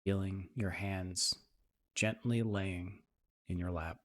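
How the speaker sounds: soft, even meditation voice with long pauses; guided meditation. Acted. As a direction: ease listeners into calm, leaving space between phrases.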